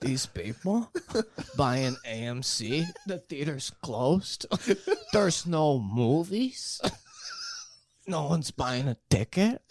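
incredulous comic impression, drawn out; slow phrases with baffled pauses between them; Sebastian. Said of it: "rest of this podcast as Sebastian".

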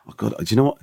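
ordinary talk

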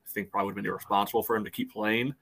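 ordinary talk

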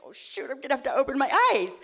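retold "oh shoot" moment, hurried and high; mock panic. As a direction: blurt it out in comic alarm. Retold panicked thought on the ride.

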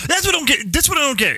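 high, baffled repeat; can't believe it, said high twice; exasperated disbelief. Real, not acted. Repeats in disbelief, much higher.

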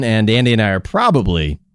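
ordinary talk

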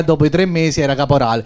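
ordinary talk

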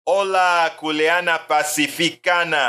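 name rolled out with a Spanish lilt; Spanish intonation. Shown as a demonstration. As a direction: greet her like a Spanish speaker. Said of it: "I just love to intonate that name in Spanish".

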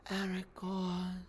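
slow, breathy elfin murmur of a name; Liv Tyler as Arwen. Retold or imitated. Mocking how Arwen says a name, slow and soft. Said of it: "All she does is go".

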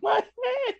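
very high squealed wail; mock screaming. Storyteller mimicking the girl after her punch, mock screaming. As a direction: whine it in a high, put-on hurt voice. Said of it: "So she starts screaming".

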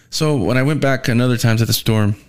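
ordinary delivery

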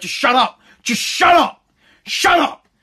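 high-pitched reenacted outburst; building mock yell at a barking dog; exasperated yell. Acted yelling at the barking dog.

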